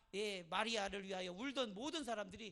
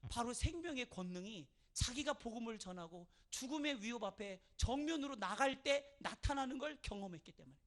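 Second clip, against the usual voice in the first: usual speaking voice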